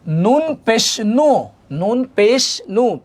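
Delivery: slow recited নু with a round u; rounded-lip peshe. Shown as a demonstration. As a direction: draw out "নুন পেশ নু", rounding the mouth on the u. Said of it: "মুখ গোল হবে".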